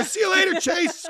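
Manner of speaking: laughing high farewell; gleeful high taunt. Retold or imitated. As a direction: toss it off like a cheeky kid. Cheeky goodbye to star.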